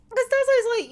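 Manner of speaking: normal talking voice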